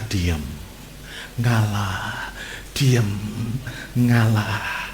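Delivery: low, soft, spaced-out urging; Holy Spirit's prompting. Acted. Holy Spirit speaking to an angry spouse, hushed urging. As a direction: murmur it low, word by word. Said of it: "tapi Roh Kudus berkata".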